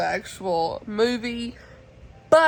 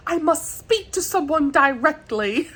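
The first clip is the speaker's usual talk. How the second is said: high, hammed-up British accent; attempted British accent; 7 semitones higher, 3 dB louder than usual. Vlogger imitating period drama line, mock British accent. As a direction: put on a fancy, matter-of-fact British accent. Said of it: "I'm not good at accents".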